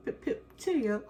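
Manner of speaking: put-on goofy British accent, second try; mock British accent. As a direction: overdo the British "Pip, pip, cheerio" for a laugh. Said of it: "That's not how she talks".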